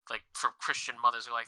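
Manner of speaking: ordinary talk, nothing marked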